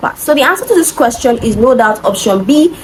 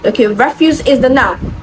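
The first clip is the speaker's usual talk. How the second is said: ordinary talk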